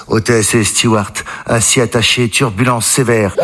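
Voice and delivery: low, slow cockpit announcement voice; captain's announcement. Acted. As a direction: announce it like a captain, pitched well down. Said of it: "le commandant de bord dit". (annonce de pilote imitée, voix grave et lente)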